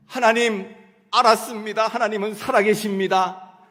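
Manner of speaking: a loud, fervent declaration of faith; a man shouting his thanks to God after being healed; fervent loud prayer. Retold or imitated. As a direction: cry it out to God, loud and sure. Prayer quoted in a testimony.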